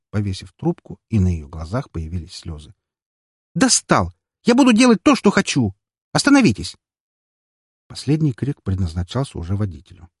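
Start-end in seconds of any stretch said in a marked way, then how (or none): 3.5-6.8 furious yelling. A narrator's reading. Furious girl yelling in taxi.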